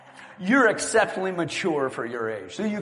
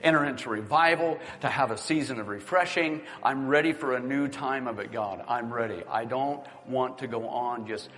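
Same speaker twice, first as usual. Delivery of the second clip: earnest prayer; 6 semitones lower than usual. Real, not acted. Leads congregation in prayer.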